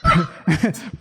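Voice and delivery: reading through giggles; amused reader cracking up at the script; laughing. Real, not acted. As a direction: laugh your way through the line. Laughs while reading crude line.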